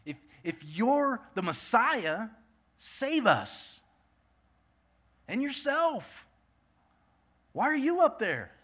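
high, loud jeering shout, voicing the taunting criminal; mocking criminal's yell. Criminal on the cross taunting Jesus, a loud high mocking yell. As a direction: throw the mockery up at him, loud and high. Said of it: "is yelling at, at Jesus, saying".

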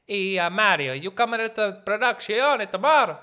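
loud, high mock Italian accent calling Mario. Mocking an Italian film crew, loud Italian accent. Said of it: "in an Italian produzione".

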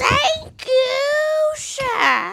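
high, drawn-out witch screech. Acted. Carol thanks the lion for praise, witch voice. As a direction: stay in the shrieking witch voice. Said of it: "I'll do my witch voice".

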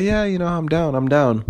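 chirpy, eager mock girl voice; imitating her. Retelling the girl agreeing to dinner, high girly imitation. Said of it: "She's like".

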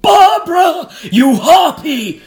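high, loud, slow anguished cry; dying cry. Acted. Dying William curses his wife, high loud anguished cry. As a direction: curse her with your last breath. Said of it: "he gasped".

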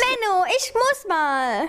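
put-on high-pitched childish whine; whining. A narrator's reading. Pupsi needs a toilet break on the hike, whining.

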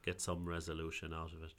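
ordinary talk

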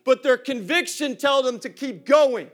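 shouted preaching to the church; full preaching. Acted. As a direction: drive the sermon home hard.

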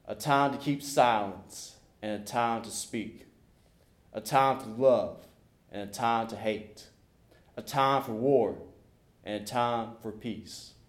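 ordinary delivery

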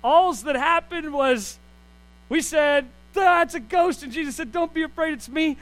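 lightly voiced Peter; Peter retelling. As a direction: tell it like Peter brushing it off. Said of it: "from the lips of Peter".

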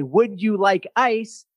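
put-on slow, drawn-out Texas accent. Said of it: "my introduction to a Texas accent".

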